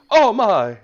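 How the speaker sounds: very high, loud startled exclamation; startled turtle. Acted. Turtle jumps back from half-giant in his face, startled cry. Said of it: "and he jumps back".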